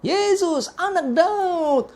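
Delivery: high, drawn-out imitated call for help; crying out. Blind beggar calling to Jesus for help, imitated crying out. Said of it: "langsung dia berteriak".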